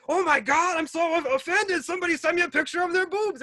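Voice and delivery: high-pitched fake outrage; mock offended. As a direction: whine it as a pretend scandalized victim. Mocking someone offended by boob picture.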